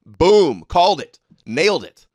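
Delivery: loud gloating shout of victory; triumphant boom. Real, not acted. Streamer crowing to his chat about being proven right, loud and triumphant. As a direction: bark it out like you just won the bet.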